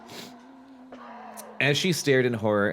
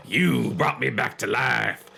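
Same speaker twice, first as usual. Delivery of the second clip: raspy voice; slower than usual. A narrator's reading. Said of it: "he told her in his raspy voice".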